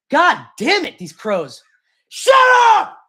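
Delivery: real exasperated yell, high and loud. Real, not acted. Man yelling out the window at noisy crows, exasperated shout. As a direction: shout them down, fed up. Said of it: "Did you hear me yelling?".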